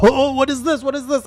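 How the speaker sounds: high alarmed question; startled. Retold or imitated. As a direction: caught off guard by a charging giant. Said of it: "I was like".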